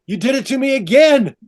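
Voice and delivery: high, indignant outcry; mock accusation. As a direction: play an aggrieved man blaming his partner. Adam accuses Eve.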